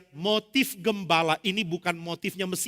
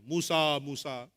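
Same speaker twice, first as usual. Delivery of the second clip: slow calling; 3 semitones lower, slower than usual. Acted. God calls Moses.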